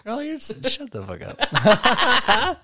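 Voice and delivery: high, squealed, laughing comeback; laughing mock scolding. Co-host teasingly telling someone off over croissant, laughing mock scolding. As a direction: drag it out loud and high through laughter.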